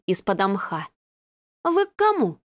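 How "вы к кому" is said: surprised receptionist. A narrator's reading. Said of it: "осведомилась Людочка".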